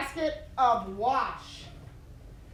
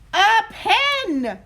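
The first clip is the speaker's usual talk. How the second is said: louder, higher, fed-up father; exasperated repeat; 11 semitones higher, 7 dB louder than usual. Acted. Father repeating himself to Buddy, exasperated. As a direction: spell it out impatiently.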